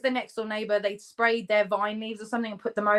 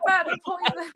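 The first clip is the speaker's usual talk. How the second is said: laughing; 9 semitones higher than usual. Shown as a demonstration.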